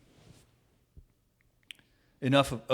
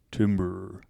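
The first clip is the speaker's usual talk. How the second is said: deep voice attempt; 4 semitones lower, 4 dB louder than usual. Shown as a demonstration. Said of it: "you have a deeper voice than me".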